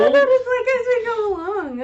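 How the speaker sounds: high, loud flustered protest; shocked protest. Real, not acted. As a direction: react in flustered disbelief.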